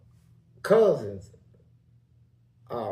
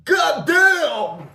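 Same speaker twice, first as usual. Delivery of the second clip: high, loud retold holler; imitated outcry; 12 semitones higher, 9 dB louder than usual. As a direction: holler it like the man suddenly finding speech. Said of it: "then I heard him talk".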